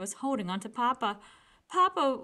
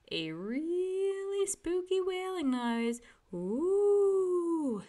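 high, slow, eerie ooh; spooky wail; 9 semitones higher, much slower than usual. A narrator's reading. Brother wails in the dark bedroom to scare Sister, ghostly moan. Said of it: "making a wailing noise".

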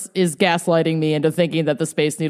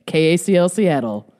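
ordinary delivery

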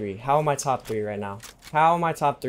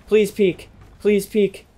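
loud, higher, urgent begging; loud plea; 7 semitones higher, 5 dB louder, slower than usual. Real, not acted. Gamer loudly begging the enemy to peek, urgent plea. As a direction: call it out, pleading hard.

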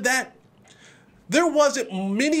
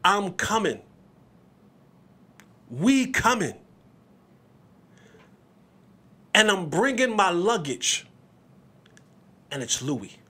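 quoting Deion; 2 semitones lower, slower than usual. Retold or imitated. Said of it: "He said it. He told us".

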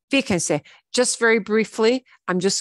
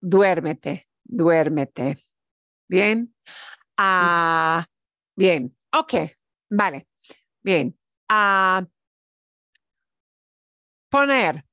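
ordinary delivery

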